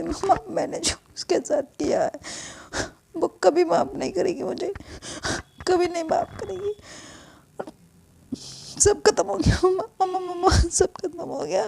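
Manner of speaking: high, tearful, half-whispered then rising; falling apart in front of mom; repeating mama through tears; distraught daughter. Acted. Daughter breaking down to mother.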